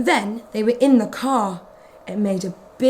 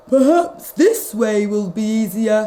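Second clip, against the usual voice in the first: lightly voiced weary vet; knowing sigh of a vet; vet's voice. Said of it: "said the vet".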